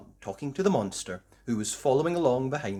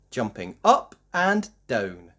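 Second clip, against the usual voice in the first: ordinary delivery, nothing marked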